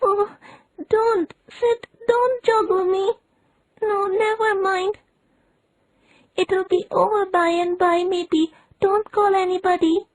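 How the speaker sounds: put-on milk every word like a dying child.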